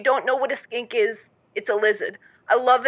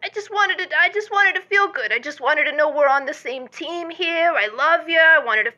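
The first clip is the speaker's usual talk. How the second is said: whiny plea; 7 semitones higher, 3 dB louder than usual. Acted. Caller pleading to be reassured.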